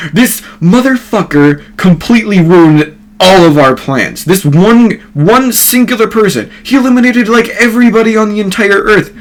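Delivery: high, loud, outraged complaint; exasperated rant. Acted. Obito complains to Madara about the ruined plans, exasperated rant. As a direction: rant it high-pitched, words piling up indignantly.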